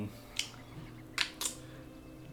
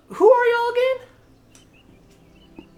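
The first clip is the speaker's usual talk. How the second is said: squeaky question; 7 dB louder than usual. Acted. Brother asks in character.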